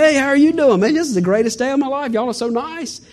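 bright, high mock cheer to the strangers; mock cheery greeting. Preacher retelling waving at drivers, mock overjoyed.

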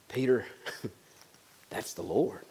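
hushed joyful wonder; soft laughing awe. Retold or imitated. John tells Peter in the boat it's the Lord, softly laughing.

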